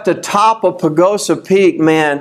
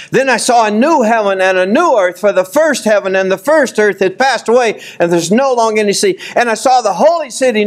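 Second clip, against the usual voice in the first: high, ringing preacher's reading of scripture; preacher reading Revelation aloud to the church; 6 semitones higher than usual. Acted. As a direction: proclaim the verses to the congregation. Reads scripture aloud, raised.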